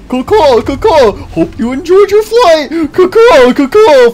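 high, loud cawing bird voice; shrill screeching bird woman. Acted. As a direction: caw cheerfully like a big bird. Bird drops them off.

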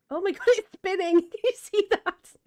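high, loud laughing delight; laughing excitedly. Real, not acted. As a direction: laugh through it, thrilled. Fish spinning, laughing.

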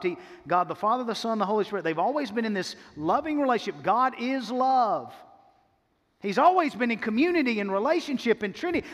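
normal talking voice

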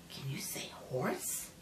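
hushed gentle prompt; whisper. Acted. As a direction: whisper the question softly.

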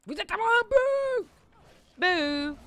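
high, loud hammed-up boo; playful attack taunt; mock monster cry. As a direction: pounce on them with a playful roar. Charging enemies in game.

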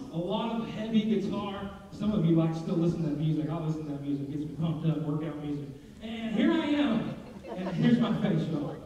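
normal talking voice

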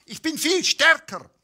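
high, loud, croaky boast from a tiny egg; shrill-voiced egg picking a fight; squeaky little egg. A narrator's reading. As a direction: puff up like a cheeky egg. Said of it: "krächzte das Ei".

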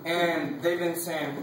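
ordinary talk

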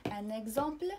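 ان اكزامبل with a clear gz in the middle; French ان اكزامبل, x sounded as voiced gz. Shown as a demonstration. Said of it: "كنسمعوا كز".

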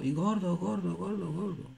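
fading echo. Retold or imitated. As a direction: fade each repeat. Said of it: "Así decía ... el eco".

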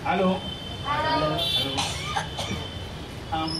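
usual speaking voice

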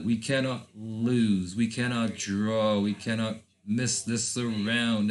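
slow, weighted insistence; slow, emphatic resolve. Real, not acted. Player tells himself the match is must-win, slow and emphatic. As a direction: spell out the stakes word by word, slowly.

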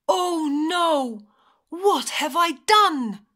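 loud, high wail of dismay; howled. A narrator's reading. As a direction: howl it out as the horrified wolf. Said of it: "he howled".

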